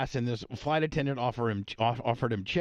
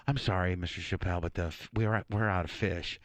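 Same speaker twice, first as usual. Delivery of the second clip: lower, polite attendant voice; flight attendant; 7 semitones lower, 3 dB quieter than usual. Retold or imitated. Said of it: "one comes back and goes".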